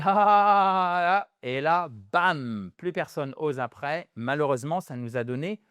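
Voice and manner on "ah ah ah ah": long loud drawn-out "haaa"; imitated classroom hoot, higher and stretched out; mocking class jeer. Everyone at school teasing the pupil who tries an accent, mocking jeer. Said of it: "tout le monde dit".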